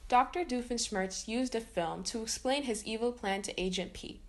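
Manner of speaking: ordinary delivery, nothing marked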